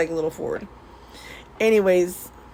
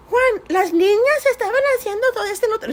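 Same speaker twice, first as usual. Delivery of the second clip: high, fast tattling mom in Spanish; mom complaining in Spanish; 12 semitones higher, faster than usual. Retold or imitated. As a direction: rattle off the kids' crimes to the husband. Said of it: "she'd be like".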